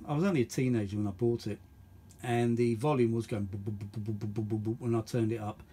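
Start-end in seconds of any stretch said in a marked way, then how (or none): none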